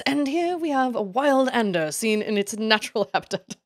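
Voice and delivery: lightly voiced hushed documentary narrator; a hint of David Attenborough narrating; nature documentary. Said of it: "David Attenborough voice".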